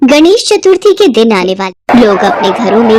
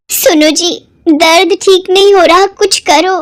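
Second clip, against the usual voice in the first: in pain; 7 semitones higher than usual. Acted. Stepmother pleading, still in pain.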